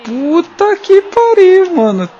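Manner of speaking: high, loud, slow furious yell; frustrated shout. Real, not acted. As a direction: yell out in disbelief and anger. Conceding a late goal.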